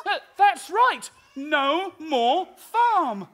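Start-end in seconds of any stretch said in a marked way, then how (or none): 1.3-3.3 slow dramatic pronouncement. Acted. Slow repeat.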